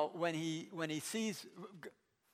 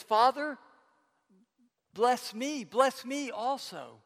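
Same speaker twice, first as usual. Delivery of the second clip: acted plea, higher and louder; pleading; 5 semitones higher, 8 dB louder, slower than usual. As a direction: beg a father for his blessing, insistently. Acting Esau begging his father.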